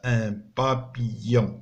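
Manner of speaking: Un papillon said very slowly, each syllable drawn out; teacher pronouncing for pupils; slow model word. Shown as a demonstration. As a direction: stretch Un papillon for the class. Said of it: "teacher models French words".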